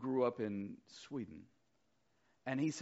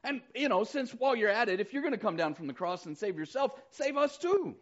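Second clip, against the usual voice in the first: mocking demand; 7 semitones higher, 4 dB louder than usual. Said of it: "hurled insults at him".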